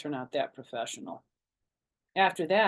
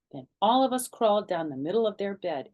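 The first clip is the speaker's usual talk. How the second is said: ordinary delivery, nothing marked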